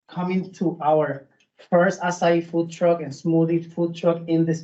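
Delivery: ordinary delivery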